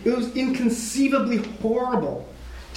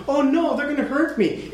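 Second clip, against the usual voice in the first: higher, mock-scared whine; badly faked worry, pitched up; mock frightened; 6 semitones higher than usual. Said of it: "He wasn't like".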